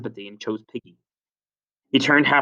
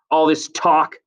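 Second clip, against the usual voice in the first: slightly raised, louder complaint; irritated complaint; 5 semitones higher, 5 dB louder, slower than usual. Acted. Jack complains about Ralph, raised irritated voice. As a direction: complain forcefully, short of a shout.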